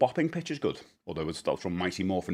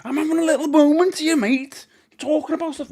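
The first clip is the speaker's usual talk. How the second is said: much higher, playful squeaky voice; 7 dB louder than usual. Real, not acted. Teasing Josh who interrupted his little moment, in a much higher squeaky voice. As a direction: pitch it way up, teasing.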